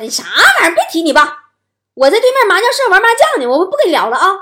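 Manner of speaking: loud, high-pitched brush-off; acted-out mother cutting the call short, loudly; impatient mom. Mom at mahjong to her calling child, impatient and loud.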